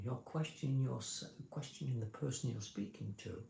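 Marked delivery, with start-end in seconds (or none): none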